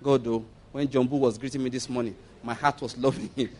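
low, soft mock confession; hushed, sheepish acted confession to God; confessing in prayer. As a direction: murmur a penitent prayer, low and slightly mocking. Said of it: "So you will confess".

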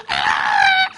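a short piercing play scream; child's scream. Pretend. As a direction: shriek like a frightened child. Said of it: "The child will scream".

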